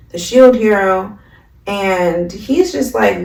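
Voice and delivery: ordinary talk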